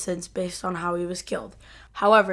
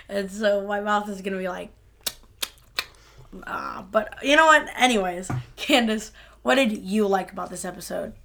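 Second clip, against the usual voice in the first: ordinary talk, nothing marked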